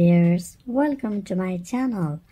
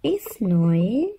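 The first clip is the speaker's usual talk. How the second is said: usual speaking voice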